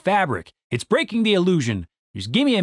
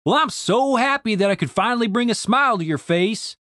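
raised-pitch mock gladness; mildly sarcastic; 7 semitones higher than usual. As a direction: feign delight at a friend's amusement. Randy sarcastic at Denise's grin.

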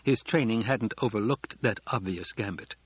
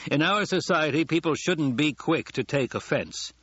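raised, pointed public remark; addressed to no one; 6 semitones higher than usual. Acted. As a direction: pitch it over the guests' heads, higher. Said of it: "spoke as though to no one in particular".

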